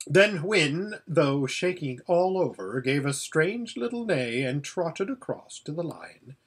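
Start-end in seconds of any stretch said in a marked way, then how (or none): none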